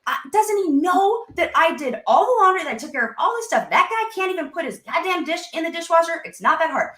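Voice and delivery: imitating a resentful wife ranting about the dishes; exasperated rant. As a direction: vent the unfair load, voice cracking. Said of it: "So if your thoughts are".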